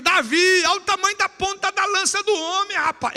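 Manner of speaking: high loud shout; shouted warning. Retold or imitated. Someone in the crowd shouting at Davi, high loud call. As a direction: call out across the valley. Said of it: "Alguém grita".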